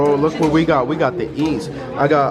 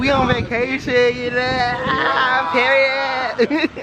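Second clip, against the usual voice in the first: high, giggly vacation voice; playful high-pitched hype; 9 semitones higher, much slower than usual. Pretend. Friends hyping their vacation to the camera, playful high voice.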